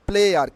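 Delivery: "player" ending in a sounded R; wrong pronunciation. Shown as a demonstration. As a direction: say "player" as loud "ple-ar". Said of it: "not player". ("player"-এর শেষে র উচ্চারণ করে)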